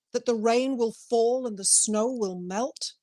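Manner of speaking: ordinary delivery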